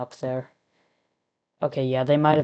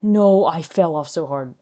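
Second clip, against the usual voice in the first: loud groan of frustration; a loud frustrated no; frustrated cry; 3 semitones higher, 10 dB louder than usual. Real, not acted. Failing a level.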